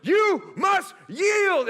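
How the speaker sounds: high, slow battle shout; heroic yell. Retold or imitated. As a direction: belt it out like a superhero. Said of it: "I literally yelled".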